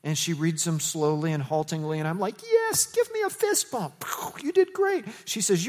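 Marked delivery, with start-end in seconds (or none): 2.4-5.0 high-pitched, drawn-out praise; excited cheer. Retold or imitated. Pastor acting out cheering a little girl's reading, excited. Cheering a child who read.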